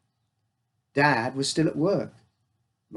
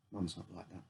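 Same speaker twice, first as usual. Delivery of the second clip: much softer, low and heavy; quiet; 6 semitones lower, 18 dB quieter than usual. A narrator's reading. Father quietly telling his son about grieving mum. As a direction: say it softly, weighed down.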